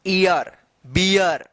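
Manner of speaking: ईयर and बियर each said slowly with a clear "i-yar" glide; ea-r words sounded out. Shown as a demonstration. Teacher gives sample rhyming words for learners, slow and separate. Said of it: "जैसे डियर".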